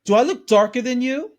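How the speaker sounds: hammed-up offended retort; affronted mock quote. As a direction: fling it back as an outrage. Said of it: "I says".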